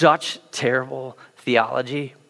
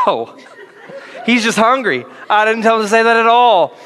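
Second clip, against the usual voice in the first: higher, laughing mock reply; laughing Holy Spirit; 8 semitones higher, 4 dB louder than usual. Holy Spirit denies it to the Father while laughing. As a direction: play the Holy Spirit cracking up. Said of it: "the Holy Spirit's like".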